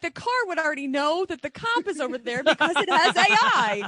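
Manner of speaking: loud, high-pitched interjection; excited objection. Real, not acted. Host interrupting the joke about cops, loud and excited.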